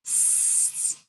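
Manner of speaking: "S" as a long unvoiced hiss. Shown as a demonstration. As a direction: hiss "S" like a snake. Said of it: "what we do to make S".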